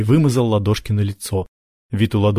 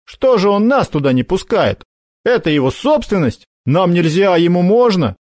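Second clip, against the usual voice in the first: put-on indignant boy; 8 semitones higher, 3 dB louder than usual. Makar indignant.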